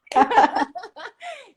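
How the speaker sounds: high, loud laugh; burst of laughter. Real, not acted. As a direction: laugh out at the joke. Joke about last minute entry.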